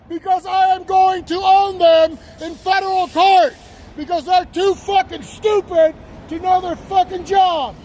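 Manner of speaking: angry jeering. Real, not acted. Man jeering as police drive away, defiant shout. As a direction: crow angrily after them as they leave.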